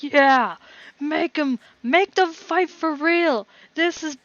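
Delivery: high, loud crowd yells; drunk crowd heckling. Acted. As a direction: heckle the fighters from the stands. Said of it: "enough drunk people in the crowd".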